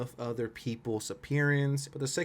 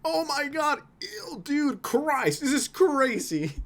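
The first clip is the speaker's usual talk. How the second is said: high-pitched mock horror; outraged chat; 13 semitones higher, slower than usual. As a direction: imitate a scandalized live chat, much higher. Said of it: "his chat's reaction when I say that".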